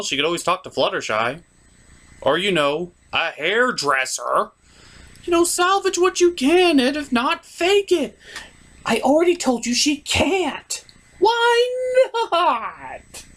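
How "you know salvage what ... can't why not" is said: much higher put-on lady voice, arguing back; girly falsetto voice. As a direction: argue with him in a high falsetto lady voice. Falsetto second voice argues back.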